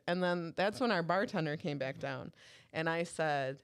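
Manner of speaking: ordinary talk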